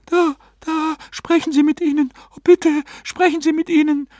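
very high, agitated woman's voice; frantic pleading. A narrator's reading. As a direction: beg urgently at the top of the pitch range. Client pleads as spirits appear.

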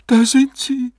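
very high, awestruck woman's voice; breathed in awe. A narrator's reading. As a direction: breathe it out high, in wonder at the spirits. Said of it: "hauchte Misses Farnsworth".

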